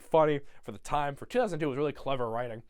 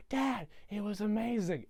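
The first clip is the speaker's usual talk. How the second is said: higher, excited little-girl imitation; 5 semitones higher, 4 dB quieter than usual. Cindy greeting her dad, high excited mock voice. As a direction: gush in a high child voice. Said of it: "she's like".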